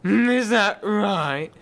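high, loud, slow outrage; offended king. Acted. King taking offense at the warlock, mock outraged. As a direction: bellow like a wounded monarch.

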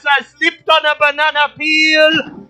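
loud, high play-by-play call; excited commentator calling the race; race announcer. Acted.

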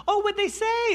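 high, loud excited wife voice; eager wife. Retold or imitated. Wife asks her husband about the call, high and eager. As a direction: play the eager, bright-eyed wife. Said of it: "she's like".

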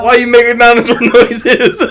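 high laughing question. Real, not acted. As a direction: ask it through laughter.

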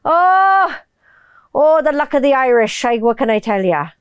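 lilting Irish accent, starting high. Shown as a demonstration. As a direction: do a believable Irish accent, not piratical. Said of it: "I can do fucking vocal matching without sounding like a".

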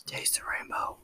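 whispered ad slogan; whispered candy slogan; mock ASMR whisper. Joining the ASMR whisper bit.

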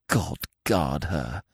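hushed, slow and low; soft, drawn-out near-whisper. A narrator's reading. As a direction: murmur it slowly like a prayer. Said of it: "he whispered".

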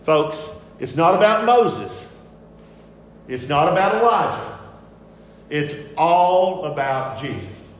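slow, weighted declaration; emphatic preaching. Retold or imitated. Preacher voices God's message to the congregation, emphatic. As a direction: press each point home, stretching the key words. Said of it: "God is saying emphatically from heaven".

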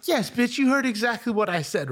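high-pitched, emphatic comeback; retold defiant retort. Storyteller answering the driver back, retold defiant retort. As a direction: fire back with mock exasperation.

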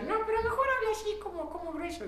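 high, quick teasing voice; lightly voiced pushy classmate; mock urging friend. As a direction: mimic them egging him on. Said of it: "me decía".

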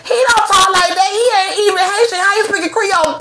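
high-pitched mocking protest; mock objector. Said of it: "I bet your ass say".

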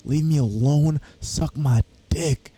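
slow, drawn-out mock telling off; lightly acted, slow brush-off to the coworker; retold telling off. Retelling the argument, slow mock telling off. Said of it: "And I was like".

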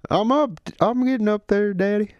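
put-on high-pitched, flustered Southern boy; Southern accent. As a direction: answer Daddy in a high Southern voice. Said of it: "Southern accents?".

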